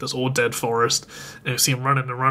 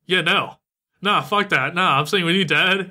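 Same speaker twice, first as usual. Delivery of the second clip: put-on slightly higher, mildly defiant kid; lightly voiced child refusing to go; stubborn refusal; 5 semitones higher than usual. Young son refusing to leave his dad, mildly defiant.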